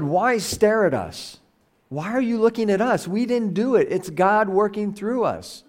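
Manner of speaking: high-pitched, acted-out disclaimer; mock incredulous protest. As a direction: protest as a baffled apostle refusing credit. Acting out apostles deflecting the crowd's attention.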